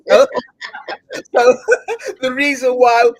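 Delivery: loud words breaking into real laughter; cracking up. Real, not acted. Host joking about spitting when speaking, cracking up. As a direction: try to explain through helpless giggles.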